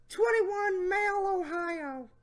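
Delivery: high put-on voice; Jeff Stacy voice. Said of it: "my Jeff Stacy voice".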